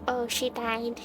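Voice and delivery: usual speaking voice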